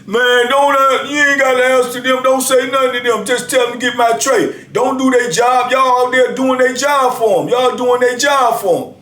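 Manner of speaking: high, scornful holler; mock hollering inmate. Inmate jeering at the workers from his cell, high scornful holler. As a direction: jeer at them through the door. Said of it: "hollered out the door again".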